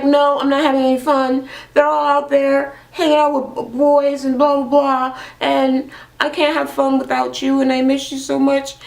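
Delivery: high, mock-pitiful moaning to her sister; whiny complaint. Homesick sister complains on retold phone call.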